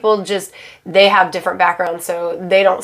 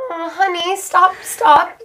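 high-pitched, pitying plea to stop; exasperated, high "oh honey" plea; pleading intervention; 9 semitones higher, slower than usual. Retold or imitated. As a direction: gently beg someone to quit, pitched up. Pleading with misled client.

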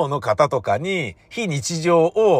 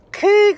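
high, loud satisfied ahh after a sip; much higher, loud refreshed grunt; beer gasp; 6 dB louder than usual. Retold or imitated. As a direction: let out a pleased beer sigh. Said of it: "かなんか言って".

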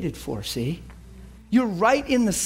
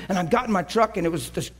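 normal talking voice

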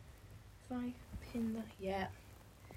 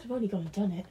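normal talking voice

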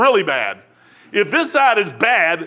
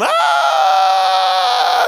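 falling scream; 4 dB louder than usual. Acted. Men falling into the pit.

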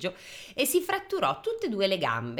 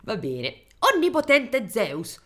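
higher, louder and slow, full of contempt; scornful shout; 5 semitones higher, 5 dB louder, slower than usual. Acted. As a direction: sneer it at the king of the gods. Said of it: "urlò Efesto in modo sprezzante".

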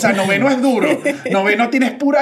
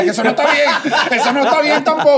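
mock protest; 6 semitones higher, 3 dB louder, faster than usual. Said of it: "Y yo".

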